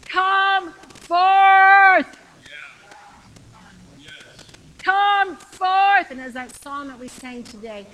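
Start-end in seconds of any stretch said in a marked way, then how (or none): 0.0-6.1 high, ringing double shout; commanding shout. Acted. Jesus calling the listener out of the grave, a commanding shout. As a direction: call them out with full force. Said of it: "he's shouting to you".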